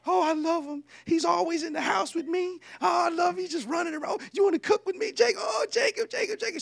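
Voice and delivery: high falsetto mother gushing over her son; hammed-up, high-pitched motherly fawning; doting mama. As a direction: squeal it like a smitten mom. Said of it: "But the mama, she loved Jacob".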